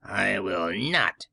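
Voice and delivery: low, slow witch voice, refusing flatly; a wicked old witch digs in; low drawn-out witch refusal; retorting witch. Acted. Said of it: "retorted the witch".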